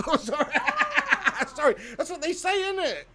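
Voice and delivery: high-pitched, helpless laughter through the words; real giggling fit, squeezing words out; laughing apology. Real, not acted. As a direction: crack up while apologising. Cracks up after his rude impression.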